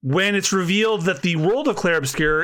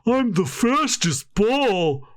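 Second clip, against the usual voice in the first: slow, drawn-out character voice, pitched up; booming gentle giant; 6 semitones higher, much slower than usual. Acted. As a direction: play a big booming cuddly creature on the run. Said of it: "a pretty good impression of him".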